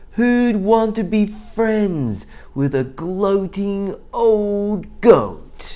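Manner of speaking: high, sneering crowd voice; mocking townsfolk. People below jeering at the goat, high and mocking. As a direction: jeer up at the goat.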